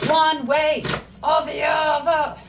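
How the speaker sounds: exaggerated singsong delivery; playful drawl. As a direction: stretch each word out playfully. Playfully repeats the phrase, drawn out.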